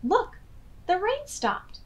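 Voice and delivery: high, excited shout. Acted. Hop shouts to Hip that the rain has stopped, high and glad. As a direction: call out with joy. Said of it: "shouted Hop".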